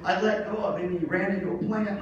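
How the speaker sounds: ordinary delivery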